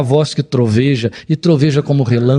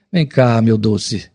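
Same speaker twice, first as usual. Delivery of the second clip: tender father; 5 semitones lower, much slower than usual. Acted. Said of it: "fala carinhosamente".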